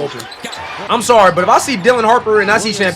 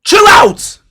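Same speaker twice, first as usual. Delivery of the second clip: shouted reaction; 11 semitones higher, 5 dB louder than usual. Real, not acted. Reacting to a big play.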